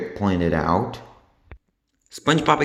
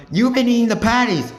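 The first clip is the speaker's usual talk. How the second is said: high, loud accusing scream; 13 semitones higher, 4 dB louder than usual. A narrator's reading. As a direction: shriek it in outrage. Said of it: "screamed SpongeBob".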